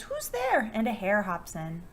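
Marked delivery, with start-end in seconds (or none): 0.0-0.7 high, small frightened squeak; squeaky mouse voice. Acted. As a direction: cry out like a tiny mouse. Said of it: "Mouse cries".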